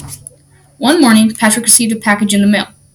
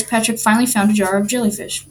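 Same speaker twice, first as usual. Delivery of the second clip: ordinary delivery, nothing marked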